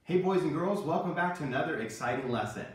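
usual speaking voice

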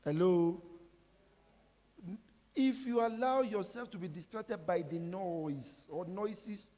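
ordinary talk, nothing marked

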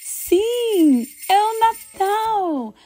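high, joyful shout of Christmas; excited reveal. Acted. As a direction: announce it with big delight.

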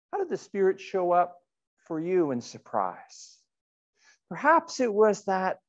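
usual speaking voice

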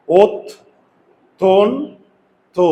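ওত, তন, তো as three loud, separate beats; teacher chopping the word into "ot, ton, to"; syllable breakdown. Shown as a demonstration. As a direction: punch out ওত... তন... তো with pauses. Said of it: "তিনটা syllable".

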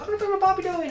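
high-pitched mock girl voice; put-on girly voice; little girl. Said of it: "this girl's like".

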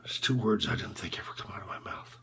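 very low, barely voiced near-whisper; drained, whispery self-disgust; ashamed confession. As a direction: confess it in hushed fragments with long pauses. Coach's ashamed confession of cheating.

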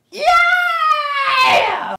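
high, loud excited yell; excited shout. Real, not acted. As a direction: cheer out with a burst of joy. Excited cheer at object reveal.

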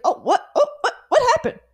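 a silly panicked God; flustered God voice. Pretend. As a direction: play God as totally surprised. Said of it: "I don't know why I did that voice".

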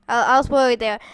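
ordinary talk

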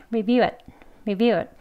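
review it run together quickly, it nearly swallowed; fast speech. Shown as a demonstration. Teacher models the fast casual form. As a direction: rattle off review it as in quick talk. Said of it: "더 빨리 발음할 때".

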